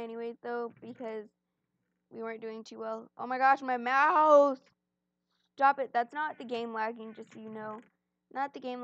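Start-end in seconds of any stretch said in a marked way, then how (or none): 3.2-4.6 loud frustrated exclamation; frustrated shout. Real, not acted. Mouse lagging mid-game. 5.6-5.9 frustrated shout. Real, not acted. Mouse lagging mid-game.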